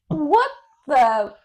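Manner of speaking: retold stunned exclamation; indignant shock. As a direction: act out an outraged double take. Retelling shock at son running off.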